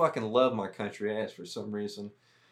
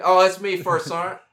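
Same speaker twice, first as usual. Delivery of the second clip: loud confession; 7 semitones higher, 10 dB louder than usual. Retold or imitated. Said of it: "And I said".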